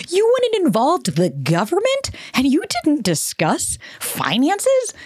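high, slow, half-whispered disbelief; incredulous scolding. Acted. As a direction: drag the questions out as if you can't believe it. Scolding the TV couple.

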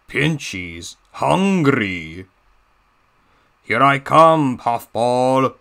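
slow gloating Russian-tinged villain; a hint of a Russian heavy closing in; teasing Russian-accented threat; Diesel 10 Russian accent. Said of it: "my Diesel ten voice impression of him sounding all Russia".

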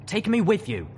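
high, loud, urgent command. A narrator's reading. Trapped colonel to the rescuer, commanding. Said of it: "Colonel Autumnleaf commanded".

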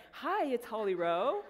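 drawn-out on-camera greeting, lifted from a murmur; imitating TV reporter. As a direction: switch on the mock broadcast hello. Interviewer switching to on-camera greeting.

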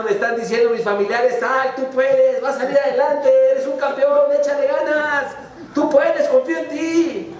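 high, eager encouragement; imagined cheering. Acted. Deaf frog hears encouragement from relatives, high cheering. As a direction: cheer yourself on.

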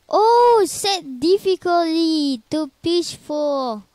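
a high yelp, then loud rushed pleading; panicked shout. Real, not acted. Gamer panics and begs the game for peaceful mode, shouting. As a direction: yell the command in a fright.

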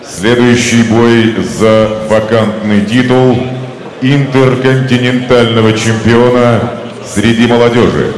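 booming ring announcer, names drawn out; ringmaster. Acted. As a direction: announce it like a title fight. Boxing ring announcer introducing fighters.

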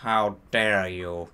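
low, slow indignant demand; Professor Snape. A narrator's reading. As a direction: drop the pitch and draw it out. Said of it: "demanded Professor Snape".

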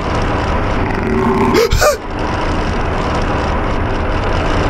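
long high unvoiced gasp; a drawn-out gasp of terror; terrified gasp. Real, not acted. Streamer terrified by a monster in the sewer, long gasp. Huge fright in horror game.

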